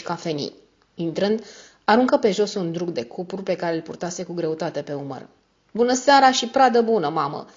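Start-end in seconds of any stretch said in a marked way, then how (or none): 5.7-7.5 raised, higher greeting with a hint of hoarseness; hoarse shout. A narrator's reading. Son greets mother on arriving with loot, hoarse shout. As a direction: call out roughly, dropping on the last word. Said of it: "strigă el cu voce spartă și răgușită".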